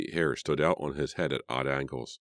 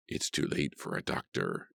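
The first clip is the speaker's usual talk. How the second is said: low, hushed, breathy snarl; a low, menacing snarl; 3 semitones lower, 6 dB quieter than usual. Acted. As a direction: hiss it through the teeth. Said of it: "Edward snarled".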